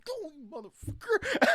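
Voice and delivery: high mock yell; high exasperated yell; retold yell. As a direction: yell it out the window. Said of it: "I literally have to yell at people".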